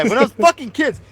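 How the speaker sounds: loud, high, joking echo; incredulous repeat. Real, not acted. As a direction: blurt the slip back loudly. Mock repeat of misheard phrase.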